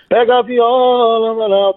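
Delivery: slow, high folksy TV host call; [name] catchphrase. Retold or imitated. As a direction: drawl it out like the old TV host. (imitando [name])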